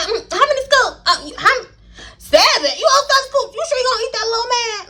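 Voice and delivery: high, sing-song teasing server voice. Retold or imitated. Narrator scooping crawfish for a small boy, high teasing voice. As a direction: tease the kid asking for seven scoops, high and playful.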